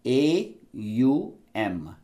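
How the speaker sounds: A, U and M said apart, very slowly; slow, spaced letters. Shown as a demonstration. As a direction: spell out A U M one by one, slowly. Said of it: "divided into three".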